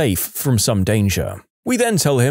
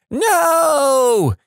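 very high, drawn-out wail; acted panicked cry, held long and high; losing it. A narrator's reading. Brother terrified of the moth, long high wail. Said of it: "My brother flips, just loses it".

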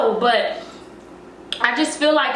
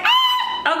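high excited squeal. Real, not acted. Excited return to camera.

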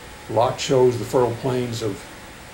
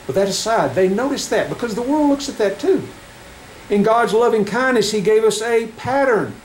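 raised, ringing pulpit voice; fervent preaching; 6 semitones higher than usual. Acted. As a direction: preach it to the congregation. Preaching scripture to congregation.

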